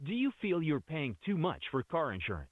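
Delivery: usual speaking voice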